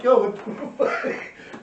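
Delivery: high, loud retold shock through laughter; shocked, laughing. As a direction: laugh through a drawn-out mock protest. His shocked reaction, retold laughing.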